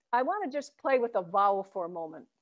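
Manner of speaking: normal talking voice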